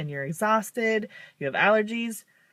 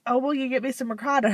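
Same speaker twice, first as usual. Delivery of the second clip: higher, fast, casual request; mock mom; 6 semitones higher, faster than usual. Mom asks her tired daughter for ricotta. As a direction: toss off mom's request lightly. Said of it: "my mom says".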